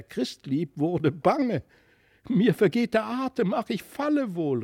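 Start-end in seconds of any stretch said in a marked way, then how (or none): 2.3-4.6 high, breathless and scared; frightened cry. A narrator's reading. Christlieb crying out in fright high in the air. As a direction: gasp it out in fear of falling. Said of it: "rief sie".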